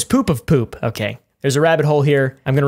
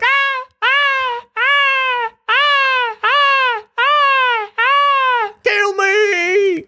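hammed-up high-pitched cries, ending in a shouted plea; shark crying out; much slower than usual. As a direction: squeal like a doomed shark begging to die. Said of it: "Look at him like".

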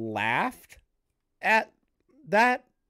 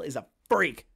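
usual speaking voice

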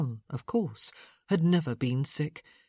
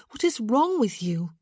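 urgent; 6 semitones higher, 4 dB louder than usual. Acted. Urgent question.

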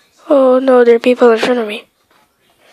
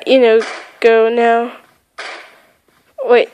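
ordinary talk, nothing marked

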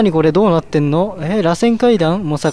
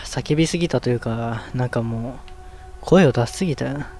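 low, soft voice after too much yelling; worn-out voice, pitch dropped; spent from screaming; 6 semitones lower, 5 dB quieter than usual. Real, not acted. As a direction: talk as if your voice is used up.

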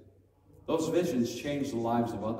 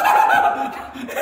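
excited laugh; 12 semitones higher, 12 dB louder than usual. Retold or imitated. Showman laughing over hat.

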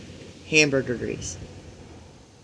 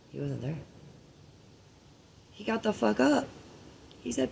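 ordinary talk

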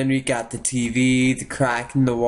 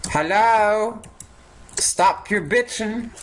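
yelling at neighbour; 8 semitones higher than usual. Acted.

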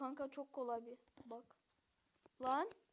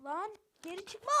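usual speaking voice

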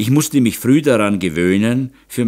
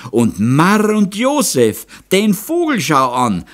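put-on girl's scream; 11 semitones higher, 5 dB louder than usual. A narrator's reading. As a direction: cry out aghast in dialect. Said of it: "schreit's Madel". (hoher, gedehnter Schreckensruf im Dialekt)

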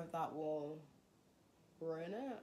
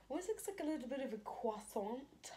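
higher, playful put-on voice for croissant; silly voice; 7 semitones higher than usual. Showing her sculpture to viewers, in a silly higher voice. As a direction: sing it out in a funny higher voice. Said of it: "Sorry, I don't know why I said it like that".

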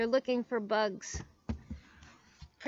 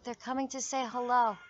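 ordinary delivery, nothing marked

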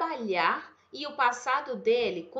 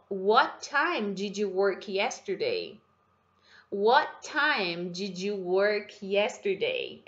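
slow, careful English question; slow pronunciation model; 2 semitones lower, slower than usual. Shown as a demonstration. Teacher slowly modeling the question for students. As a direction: lay the question out slowly for the class to copy. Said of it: "Deixa eu só te ajudar com a pronúncia".

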